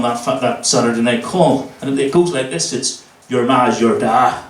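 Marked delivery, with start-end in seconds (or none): 3.3-4.4 slow, lightly voiced Northern Irish dad; low, drawn-out insult with pauses between jibes. Daughter playing her dad at school assembly, slow Northern Irish delivery. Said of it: "very Northern Irish ginger dad".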